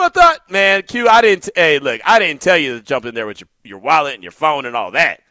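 ordinary talk

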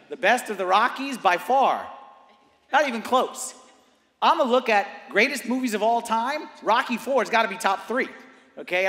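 loud, high, punchy proclamation; emphatic preacher's verdict. Real, not acted. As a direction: declare it loudly to the whole room. Emphatic rant on best film.

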